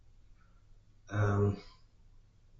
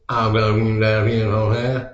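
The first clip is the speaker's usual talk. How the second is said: put-on Louisiana swamp accent; 7 dB louder, faster than usual. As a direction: ham up a Louisiana bayou local, loud and quick. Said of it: "that Louisiana swamp person was".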